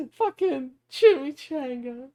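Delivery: high, slow, giggly squeak; tickled by it. Real, not acted. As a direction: squeeze it out high after laughing. Still laughing at own joke word.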